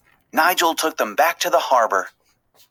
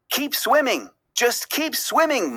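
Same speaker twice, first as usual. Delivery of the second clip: higher, steady urging chant; instructing; 8 semitones higher than usual. A narrator's reading. Marlin urging the fish in the net to keep swimming. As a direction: coach them to keep going. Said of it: "Marlin instructed".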